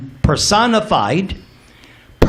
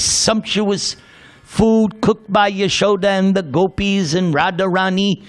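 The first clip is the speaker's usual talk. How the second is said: ordinary delivery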